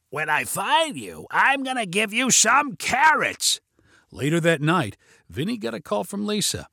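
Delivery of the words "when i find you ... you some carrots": slow raspy mock threat, rising high; Carrot Man. A narrator's reading. Vinny hunting hidden kids around the backyard, menacing raspy voice. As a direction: call out in an exaggerated rasp, drawn out. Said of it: "He used his Carrot Man voice and called out".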